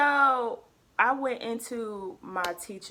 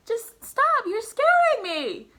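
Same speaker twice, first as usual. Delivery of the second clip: mock scared plea; 8 dB louder, slower than usual. Pleading with the dramatic teacher.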